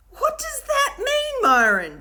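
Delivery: high, loud, slow dismayed question; dismayed demand. A narrator's reading. Will demands an answer from Myron, loud and dismayed. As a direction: insist in dismay. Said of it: "demanded Will in dismay".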